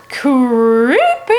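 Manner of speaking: playful spooky tone on creepy; spooky drawn-out creepy. Real, not acted. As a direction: make it sound eerie. Spooky comment after the ghost story.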